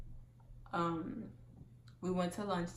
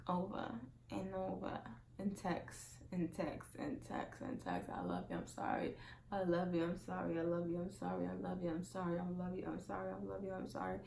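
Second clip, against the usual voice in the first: soft, monotone run of pleas; droning repetition; 7 dB quieter than usual. Retold or imitated. Vlogger imitating boyfriend's endless apology texts, droning repetition. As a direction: reel it off on a loop, quietly.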